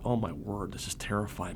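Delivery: soft, frightened inner thought; hushed dread. Retold or imitated. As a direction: breathe it out in quiet alarm. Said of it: "And I thought".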